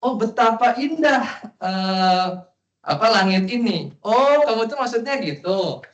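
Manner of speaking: put-on high, girlish insistent protest; daughter's protest. Teacher voices the daughter objecting, in a high girlish voice. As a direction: squeal it like a daughter correcting her father. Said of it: "Kemudian putrinya berkata".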